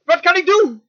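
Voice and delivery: high, urgent appeal; crying out. Acted. Moses faces trouble and calls on the Lord, crying out. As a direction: cry out helplessly to God. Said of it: "dan begint hij te roepen".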